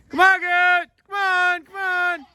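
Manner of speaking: high, loud call across a field. Real, not acted. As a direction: holler the goats in. Calling distant goat.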